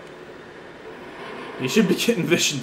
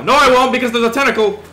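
loud, high, urgent reversal; high panicky outburst; alarmed yell; 8 semitones higher, 7 dB louder than usual. Real, not acted. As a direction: shout it in sudden alarm. Sudden tentacle threat.

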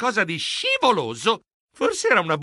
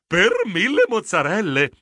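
savoring delight; 4 semitones higher, 3 dB louder than usual. Acted. Geronimo catches a sweet whiff of smoked cheese, savoring delight. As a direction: relish it with mild wonder.

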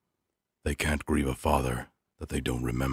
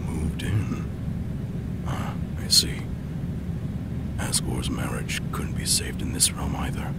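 hushed whispered inner monologue. Acted. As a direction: breathe it out like a secret thought. Whispered private thoughts at tea.

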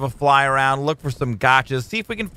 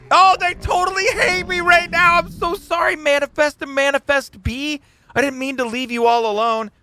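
mock apology; 9 semitones higher than usual. Apologising to his pets.

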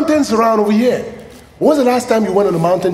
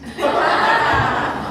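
laugh; 6 semitones higher, 3 dB quieter than usual. Real, not acted. Preacher laughs.

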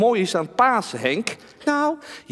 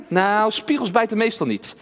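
ordinary talk, nothing marked

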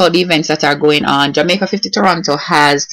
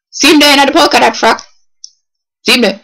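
raised-pitch mock discreet pointing-out; pointing someone out; 7 semitones higher than usual. Shown as a demonstration. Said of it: "and then point to them out".

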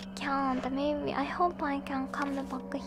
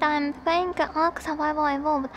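ordinary talk, nothing marked

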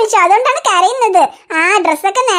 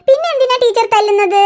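normal talking voice

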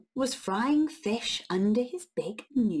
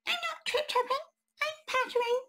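much higher squeaky mouse voice; tiny mouse voice. Acted. Mouse answering the troll in a tiny high voice. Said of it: "said the fairy creature".

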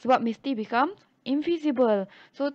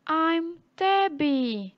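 cat voice; 5 semitones higher, much slower than usual. Acted. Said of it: "He says".

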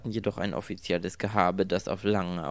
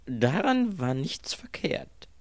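high, drawn-out, emphatic assurance; assuring; 7 semitones higher, 5 dB louder, slower than usual. A narrator's reading. Mister Eastwood reassures the girl about the kiss, emphatically. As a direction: assure her slowly and emphatically, pitched up. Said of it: "versicherte Mister Eastwood".

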